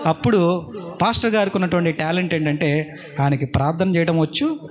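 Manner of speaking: usual speaking voice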